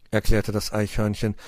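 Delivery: usual speaking voice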